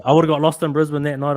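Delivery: ordinary delivery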